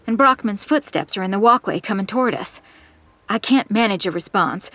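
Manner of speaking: ordinary delivery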